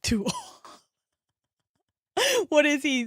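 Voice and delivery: ordinary delivery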